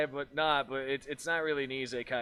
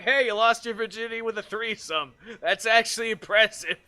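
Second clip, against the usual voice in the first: higher, louder teasing praise; mock congratulations; 6 semitones higher, 5 dB louder than usual. Viewer teasing an anime character about his first time, mock congratulations. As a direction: hype him up in mock admiration.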